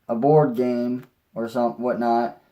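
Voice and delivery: normal talking voice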